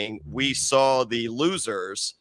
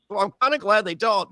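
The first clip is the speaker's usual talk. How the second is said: ordinary talk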